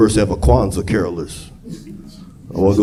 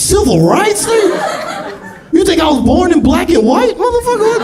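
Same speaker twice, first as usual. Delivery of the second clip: much higher incredulous shout; indignant shout. Retold or imitated. Stepdad answers stepson's question, incredulous high shout. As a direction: yell it in disbelief at the stepson.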